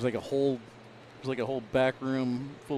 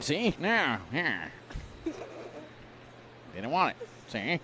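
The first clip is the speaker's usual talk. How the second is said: lightly voiced 1930s gangster commentary; play-by-play like a mild old-time mobster, ending lines with see; a hint of a Mafia wiseguy calling the game. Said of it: "do your play-by-play for this round".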